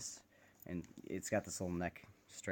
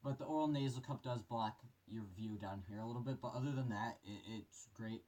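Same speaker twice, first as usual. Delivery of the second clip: muffled voice inside a respirator; through a gas mask; 4 dB quieter than usual. Real, not acted. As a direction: talk from behind a gas mask. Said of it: "put this mask on here for you guys".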